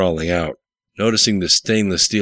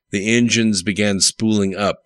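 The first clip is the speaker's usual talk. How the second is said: ordinary delivery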